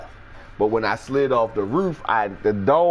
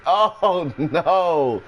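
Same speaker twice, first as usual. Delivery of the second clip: much higher, dismayed voice; a much higher voice of dread; 8 semitones higher than usual. Retold or imitated. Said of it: "So I'm like".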